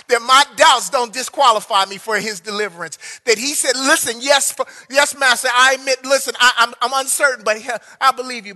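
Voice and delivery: loud, rising sermon delivery over music; full-force preaching. Acted. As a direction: preach it out, urging them to confess their doubt.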